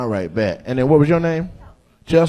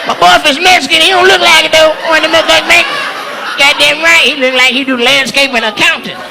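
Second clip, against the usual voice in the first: exaggerated woman's twang, pitched way up; imitating audience member; 10 semitones higher than usual. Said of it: "She's like".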